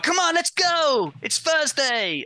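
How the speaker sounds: high, slow, chirpy gym instructor; peppy fitness instructor. Retold or imitated. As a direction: cheer like a class leader. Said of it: "they were like".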